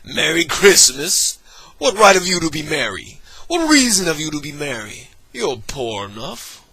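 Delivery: grumpy scolding. Acted. Scrooge mocking his nephew's cheer, grumpy scolding. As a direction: rail at the young fool.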